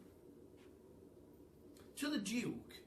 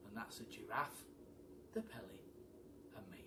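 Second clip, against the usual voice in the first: softer, fading to a whisper; soft ending; 4 semitones lower, 9 dB quieter than usual. Acted. Ending the monkey's farewell song, fading softly. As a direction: let the last words fall to a whisper.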